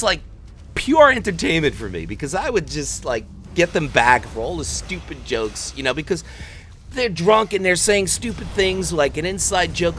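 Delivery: normal talking voice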